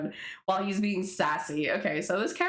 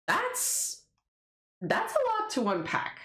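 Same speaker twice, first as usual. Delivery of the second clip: high disbelief. Real, not acted. Reacting to character twist.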